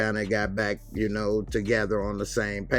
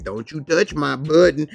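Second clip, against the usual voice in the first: higher, louder warning; stern warning; 6 semitones higher, 8 dB louder than usual. Retold or imitated. Bus driver warning Kelly, loud. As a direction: warn her off the button.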